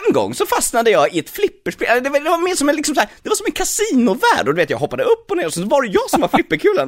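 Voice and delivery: high, excited cartoon hedgehog chatter. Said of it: "Så jag står och säger".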